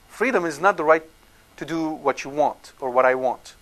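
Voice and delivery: ordinary talk, nothing marked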